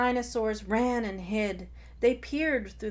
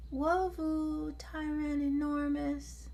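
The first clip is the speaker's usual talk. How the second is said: put-on high baby-talk gurgle; 7 semitones higher than usual. Terry thanks her brother, high baby gurgle. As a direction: gurgle it lovingly like a toddler. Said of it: "gurgled Terry".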